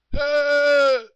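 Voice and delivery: loud high drawn-out call; hollering. Retold or imitated. Unseen hunter calling after Chet again, hollering loud and high. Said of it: "hollering at him".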